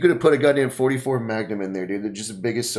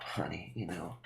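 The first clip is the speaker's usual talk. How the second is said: whispered, much softer; hushed reassurance; 7 semitones lower, 18 dB quieter than usual. Retold or imitated. Girlfriend reassuring her boyfriend, soft whisper. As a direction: breathe it gently to him. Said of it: "she'll usually be like".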